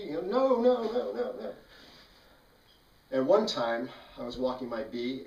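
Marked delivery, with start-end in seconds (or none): 0.2-1.5 put-on high, loud childlike no no no; scared kid refusing. Neighborhood kids turning down the offer, high and frightened. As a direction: back away from the bee like a frightened kid.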